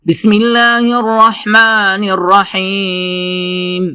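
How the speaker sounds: slow, high melodic tajweed recitation; Quran recitation. Shown as a demonstration. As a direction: recite the verse drawn out and sung. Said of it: "تو شروع کرتے ہیں سورۃ الناس کی آیت نمبر تین". (بلند اور دھیمی آواز میں قرآن کی تلاوت)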